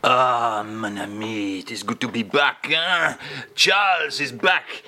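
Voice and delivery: hammed-up French accent, lightly done; a comic Frenchman greeting friends; a touch of a French accent; mock French accent. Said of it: "[name] has joined us once more".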